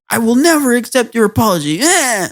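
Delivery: high, whiny imitation of a huffy kid; hammed-up childish outrage; mock indignant refusal. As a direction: refuse theatrically, ending on a bratty noise. Acts boy refusing, then running off.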